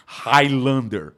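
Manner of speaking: slow, drawn-out movie title; awed reverence. Real, not acted. Teacher naming a beloved film to students, slow and awed. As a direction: announce it slowly with relish. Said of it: "até arrepio quando eu falo".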